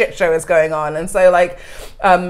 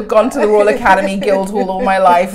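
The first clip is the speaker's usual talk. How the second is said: ordinary talk, nothing marked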